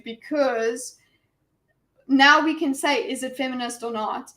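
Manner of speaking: ordinary talk, nothing marked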